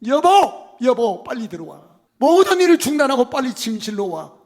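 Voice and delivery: loud, high, eager shout to his wife; Abraham bursting home, calling for her; excited calling out. Acted. (아내를 들뜬 목소리로 크게 부르기)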